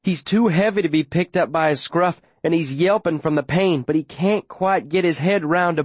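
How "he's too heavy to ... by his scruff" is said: ordinary delivery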